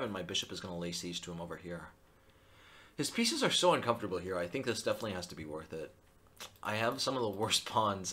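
ordinary talk

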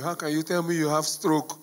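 ordinary talk, nothing marked

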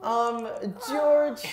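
high-pitched anime character voice; squeaky anime girl voice. Acted. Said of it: "In an anime voice, though".

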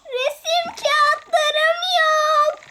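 whiny complaint. Acted. Child whining about missing paper.

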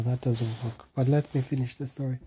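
ordinary delivery, nothing marked

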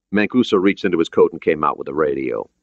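usual speaking voice

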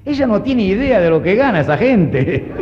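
ordinary talk

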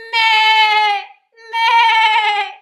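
loud, high twangy sheep "meh"; sheep bleat. Shown as a demonstration. Singing teacher leads twang practice, loud exaggerated sheep bleats. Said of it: "the sound of a sheep".

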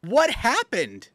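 high, slow and baffled. Real, not acted. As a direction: ask it in total disbelief. Baffled by the clip.

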